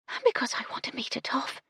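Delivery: breathy secret confession; shy whisper. Acted. Young maid confides her wish to meet a toff, whispered. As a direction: whisper it shyly.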